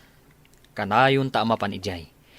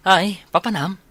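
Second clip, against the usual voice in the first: loud teasing greeting; 7 semitones higher, 8 dB louder than usual. Acted. Friend greets loudly, teasing.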